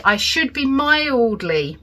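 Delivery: "I should be mildly" with "mildly" slow and weighted; stress on mildly. Real, not acted. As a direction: lean on "mildly", drawn out slowly. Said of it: "emphasis on mildly".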